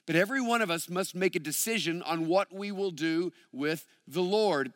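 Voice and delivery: usual speaking voice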